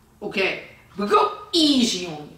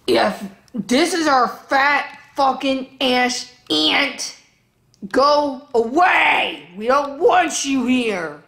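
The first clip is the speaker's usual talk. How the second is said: angry yelling; 4 semitones higher, slower than usual. Real, not acted. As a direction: yell to chase off an unwanted aunt. Yelling at aunt in comments.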